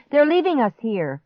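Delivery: high, distressed cry; panicked shriek. A narrator's reading. Boy sees grandparents leaving, shrieking. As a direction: wail at being abandoned. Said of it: "Clark shrieked".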